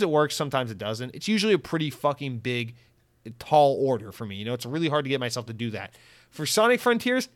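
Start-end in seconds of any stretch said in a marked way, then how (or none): 6.3-7.3 hyped. Real, not acted. Hyping the game.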